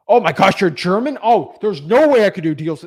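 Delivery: loud, high-pitched mock horror; mock shock. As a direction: exclaim in exaggerated disbelief. Mocks shocked reaction to being German.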